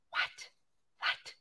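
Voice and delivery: very soft, short, disbelieving asides; hushed amazement. Real, not acted. Host seeing a donation from Spain, hushed amazement. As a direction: say it under your breath, amazed.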